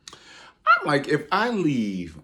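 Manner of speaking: very high, loud yelp; sudden high squeal; squealed exclamation. Real, not acted. Exclaiming mid-story.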